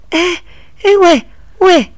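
high, loud hey hey, calling after a rider; calling out loudly. A narrator's reading. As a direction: shout to stop him, voice climbing. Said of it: "奶奶高声叫道".

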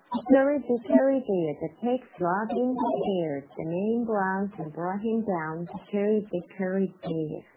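brisk run through the rhyme; fastest reading. Shown as a demonstration. Teacher reads the last of three speeds, fastest. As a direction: recite quickly, lines running straight on. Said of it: "相对来说是最快的哈".

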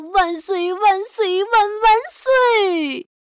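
put-on high, loud child's hurrah; cheer, building to a long last cry; joyful cheering. A narrator's reading. Boy celebrates his new handkerchiefs, joyful cheer. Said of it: "高兴地说".

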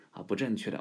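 usual speaking voice

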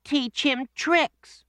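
put-on high, slow child's voice; children. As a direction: sound like an eager little kid. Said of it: "said the children".

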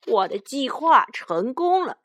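loud, high bandit boss crowing; cackly triumphant robber chief; weird gloating villain. A narrator's reading. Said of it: "强盗爸爸怪声笑道".

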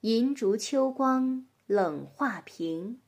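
slow, measured classical poem reading; poem recitation. Acted. Reading a Tang poem to a child, slow recitation. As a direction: recite slowly, with space between phrases.

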